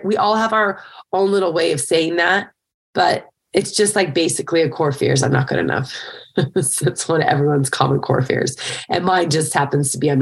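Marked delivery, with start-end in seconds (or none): none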